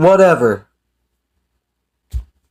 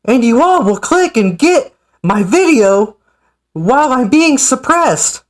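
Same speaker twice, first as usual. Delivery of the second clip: mock impression, climbing higher and higher in pitch; Ryback voice; 6 semitones higher than usual. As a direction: read the reply as a wrestler's mocking imitation, rising steadily higher. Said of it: "try to do my Ryback voice".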